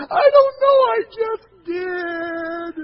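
put-on wailing five-year-old's confession; hammed-up sobbing little boy; mock crying. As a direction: bawl it high and loud, dragging out the end. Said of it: "he's crying".

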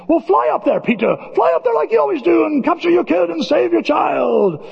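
high-pitched, shouted dare; mock taunting shout. Villain goading Peter to fly up and save his child, taunting shout. Acts out a taunting order from film.

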